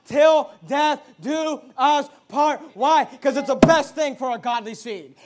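loud, punched pulpit delivery; emphatic preacher, hammering key words; forceful preaching. Real, not acted. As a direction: drive each phrase home to the congregation.

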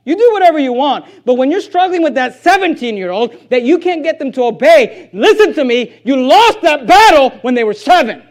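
forceful, emphatic preacher's exhortation; full-force preaching. Real, not acted. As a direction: hammer the warning home.

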